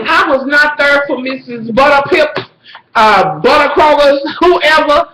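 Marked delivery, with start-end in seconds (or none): none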